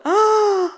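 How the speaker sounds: high startled shriek; frightened cry. Acted. Animals scared by the hen's tantrum, high shriek.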